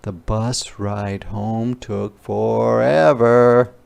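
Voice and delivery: ordinary delivery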